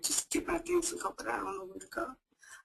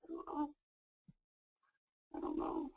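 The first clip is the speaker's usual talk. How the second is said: softer, near whisper; defeated whisper; 11 dB quieter than usual. Acted. Caller admits she has no answer, defeated whisper. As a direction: let it fade to a helpless whisper.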